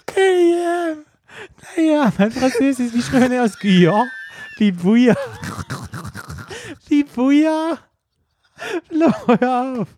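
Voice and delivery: high, squeaky laughing fit; helpless laughter. Real, not acted. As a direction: barely get the words out for laughing. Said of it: "ich muss grad echt heulen".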